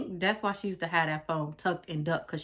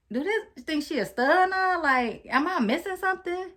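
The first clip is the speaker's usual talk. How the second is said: incredulous; 11 semitones higher than usual. Retold or imitated. Reading the texts and realizing her ex acts like a stud, high disbelief. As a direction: squeak it out in disbelief.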